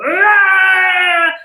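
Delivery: a sudden loud high yell of release; cathartic scream. Shown as a demonstration. Motivational speaker shows her viewers a release scream. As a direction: let it all out in one loud cry. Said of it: "feel that and go".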